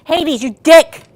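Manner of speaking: loud, slow furious shout; angry yell at the dog. Real, not acted. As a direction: yell at the dog for peeing on the carpet. Dog peed on the carpet.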